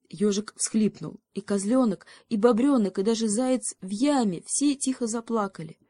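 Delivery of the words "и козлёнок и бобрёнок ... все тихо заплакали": ordinary delivery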